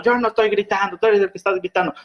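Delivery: high, indignant denial of yelling; mock indignant retort. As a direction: act the other one, defensive. Acted heated argument, other party. (réplica indignada, aguda)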